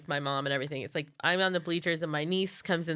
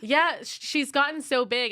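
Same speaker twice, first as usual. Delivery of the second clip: bleacher lady repeating; 7 semitones higher than usual. Retold or imitated. Stranger repeating herself for attention, raised imitated small talk. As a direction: repeat it higher, lightly pressing for an answer. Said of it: "And then she says it again".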